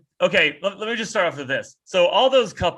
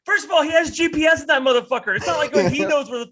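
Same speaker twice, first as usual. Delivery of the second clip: outraged shout; 11 semitones higher, 4 dB louder than usual. Real, not acted. Ranting at the commercial.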